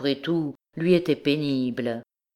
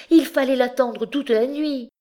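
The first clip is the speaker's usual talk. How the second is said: high, angry reproach; vehement; 10 semitones higher, 4 dB louder than usual. A narrator's reading. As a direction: flare up at him in a high voice. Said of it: "reprit-elle avec emportement".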